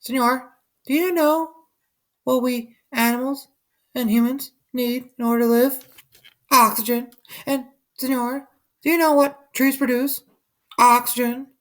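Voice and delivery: very high small voice; porcupine voices. A narrator's reading. Porcupines speaking to the sleeping man, very high. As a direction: squeak it like a little porcupine. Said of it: "and whispered to the man".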